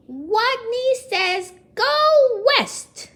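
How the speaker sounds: very high, loud call; strong call. Acted. Watney calls out the order to the whole class, loud. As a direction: call out so loud it hurts your ears. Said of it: "In a voice so strong".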